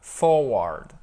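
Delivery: "Forward" with its R carefully made; English R demo. Shown as a demonstration. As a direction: model "Forward" slowly, R sounded. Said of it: "il faut bien faire le". (« Forward » articulé avec le R anglais)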